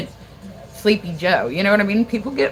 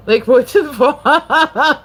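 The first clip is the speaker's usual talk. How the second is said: loud, slow laugh through the words; laughing disbelief; 7 semitones higher, 8 dB louder, much slower than usual. Real, not acted. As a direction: crack up in disbelief. Ranting about rapture believers.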